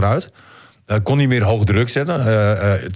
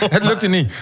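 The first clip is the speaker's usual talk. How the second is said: laughing despite trying not to; stifled giggles; 8 semitones higher, slower than usual. Real, not acted. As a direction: giggles breaking through the words. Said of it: "ik wil eigenlijk niet lachen".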